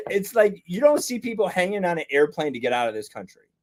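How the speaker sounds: ordinary delivery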